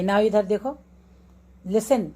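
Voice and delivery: ordinary talk, nothing marked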